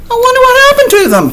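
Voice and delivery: high, fast and loud wondering; mock puzzled. Reader hamming up surprise for the kids. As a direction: gasp in playful bafflement.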